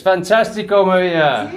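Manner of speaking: loud, high mock-Spanish cheer; excited cheer. Real, not acted. Cheering to Maria the host in mock Spanish. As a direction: throw it out with glee.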